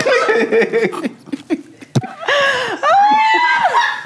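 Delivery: high stretched laugh. Real, not acted. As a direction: draw it out cracking up. Teasing, dissolving into laughter.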